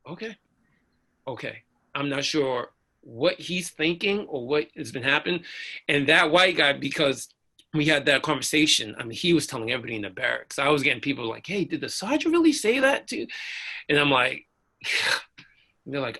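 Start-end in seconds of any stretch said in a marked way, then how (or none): 0.1-1.6 soft, hesitant okay; subdued okay. Retold or imitated. Stunned, subdued reply, retold. 11.5-13.3 high-pitched disbelieving question; astonished, high-voiced asking; incredulous question. Shocked barracks mates asking, retold.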